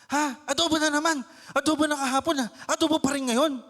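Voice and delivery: high, loud whiny protest about the same dish; annoyed complaint. Acted. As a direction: complain loudly about the adobo. Complaining about adobo again. (inis na reklamo)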